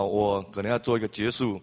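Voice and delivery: ordinary delivery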